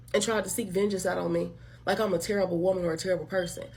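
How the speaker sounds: ordinary talk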